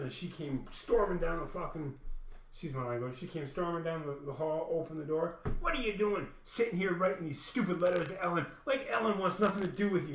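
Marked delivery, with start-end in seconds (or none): 5.6-10.1 raised, scolding voice mocking her; retold angry rant; mocking angry woman. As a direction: sneer it like the nagging stepmother. Stepmother-like woman yelling at him.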